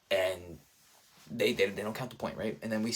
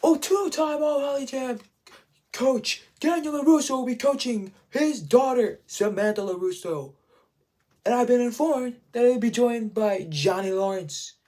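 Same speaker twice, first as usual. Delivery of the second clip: loud, high-pitched tournament announcer; referee announcer; 13 semitones higher, 8 dB louder than usual. Retold or imitated. Ref introducing coach and daughter to the crowd, loud high announcer voice. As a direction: boom out the coaches' names like a ring announcer. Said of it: "the ref comes out like".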